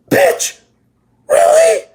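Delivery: disbelieving squeal at the mom; incredulous outburst. Retold or imitated. Reacting to mom's question in anime.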